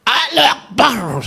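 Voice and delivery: high, slow chanted war cry; battle cry. Retold or imitated. The rebels all shouting at once, a high slow war cry. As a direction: cry it out like a crowd of fighters.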